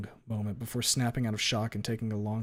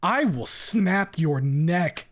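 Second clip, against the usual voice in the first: loud, slow, higher roar; 7 semitones higher, 7 dB louder, much slower than usual. A narrator's reading. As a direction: roar it as a threat. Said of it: "I roar".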